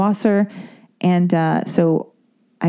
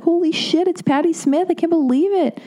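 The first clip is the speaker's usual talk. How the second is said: much higher, thrilled starstruck gasp; starstruck excitement; 11 semitones higher than usual. Retold or imitated. Seeing [name] walk out, starstruck excitement. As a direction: fan spotting a celebrity. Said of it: "and I was like".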